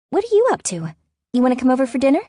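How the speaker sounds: high-pitched, lively sister on the phone; chatty sister. A narrator's reading. Sister inviting her over for dinner, chatty.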